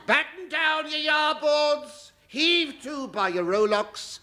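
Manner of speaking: hammed-up old sea dog bellowing orders, high and slow; lightly mock a ship's bosun; salty sailor. Comedian aping a bosun's orders to the audience, hammed-up sea dog.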